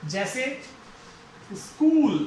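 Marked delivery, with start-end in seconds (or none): 1.5-2.1 स्कूल with a long held oo, louder. Shown as a demonstration. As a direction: stretch the oo in स्कूल. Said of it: "long sound". (स्कूल में लंबा ऊ)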